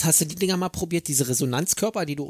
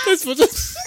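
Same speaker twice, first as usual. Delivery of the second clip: breaking into laughter; laughing hard; 14 semitones higher, 4 dB louder, slower than usual. Real, not acted. Laughing at clip.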